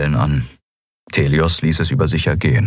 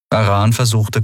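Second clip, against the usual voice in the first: ordinary delivery, nothing marked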